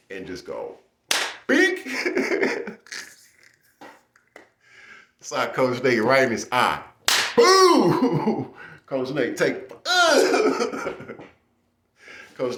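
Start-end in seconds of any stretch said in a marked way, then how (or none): 1.5-1.9 sharp high "bink" of a punch; loud high cartoon hit noise; high punch sound effect. Imitating punch on coach. 7.4-8.5 high loud "boo" of a hit; mock impact noise; loud punch sound. Imitating punch landing. 9.8-10.9 high laughing squeak; laughter cuts the word off; laughing squeal. Real, not acted. Laughing while retelling.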